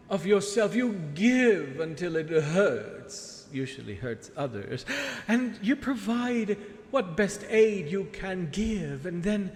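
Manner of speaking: theatrical villainess, grand and scheming; sea witch voice. Acted. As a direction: purr like a wicked sea witch.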